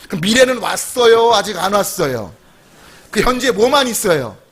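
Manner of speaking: ordinary delivery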